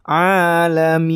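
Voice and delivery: Alamin chanted with very long final i; six-count elongation. Shown as a demonstration. Teacher demonstrates six counts, Alamin held long. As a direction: hold the min of Alamin out. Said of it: "saya pakai yang enam harkat ya".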